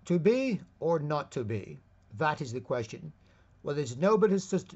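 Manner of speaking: reciting Hamlet to show how humans at their best speak; Shakespearean recitation. Acted. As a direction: declaim it like a stage actor. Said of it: "I'm trying to think of that Shakespeare".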